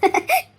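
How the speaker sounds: short, loud burst of laughter; relieved laugh. Acted. Mother-in-law hearing the match agreed, relieved laugh.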